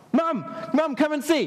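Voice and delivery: put-on high-pitched, excited child's call; child calling Mum. Child wanting Mum to see what they did, calling excitedly. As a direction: call out for Mum to come and watch. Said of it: "And you're like".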